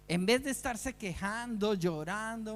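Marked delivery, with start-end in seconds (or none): none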